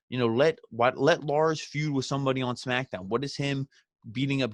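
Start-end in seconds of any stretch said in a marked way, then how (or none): none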